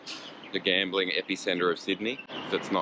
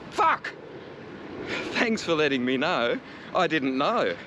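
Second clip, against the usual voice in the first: sarcastic; 6 semitones higher than usual. Retold or imitated. Sarcastic retort to motorist.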